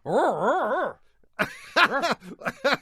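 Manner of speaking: high loud rising growl; mechanic growl. Shown as a demonstration. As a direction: growl bigger each time, like bad news. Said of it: "A whole boat".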